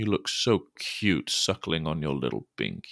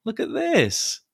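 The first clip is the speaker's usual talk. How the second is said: much higher squeal of surprise; surprised; 14 semitones higher than usual. Acted. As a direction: shoot the pitch way up. Repeated exclamation.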